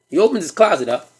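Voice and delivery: normal talking voice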